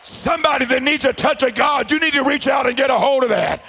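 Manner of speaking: loud, driving revival preacher's delivery; full-force preaching. Real, not acted. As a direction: preach it out hard and high to the congregation.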